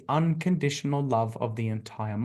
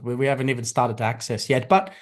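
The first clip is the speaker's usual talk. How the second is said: usual speaking voice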